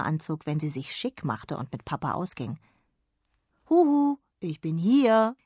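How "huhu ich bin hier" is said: higher, louder playful granny call; giggly old woman. A narrator's reading. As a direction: tease from the dark like a kindly old granny. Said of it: "kicherte eine alte, liebevolle Frauenstimme".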